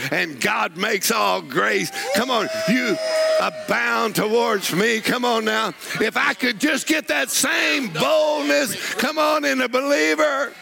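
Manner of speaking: high, building sermon climax; preaching at full force. Acted. Preacher rousing the believers to boldness, high and building.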